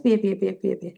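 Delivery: ordinary delivery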